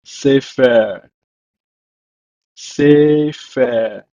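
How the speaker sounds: sefer said "seh-fehr" twice; "Sefer" said twice, falling; Hebrew word. Shown as a demonstration. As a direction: model "sefer" then repeat. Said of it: "It's pronounced in Hebrew as".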